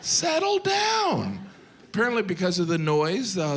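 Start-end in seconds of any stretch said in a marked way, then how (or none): 0.0-1.4 high, slow comic shout; mock calming shout. Comic yelling at noisy Spaniards to settle down. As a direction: draw out a shout to settle the crowd. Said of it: "I'm like".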